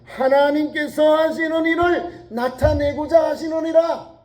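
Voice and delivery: ordinary talk, nothing marked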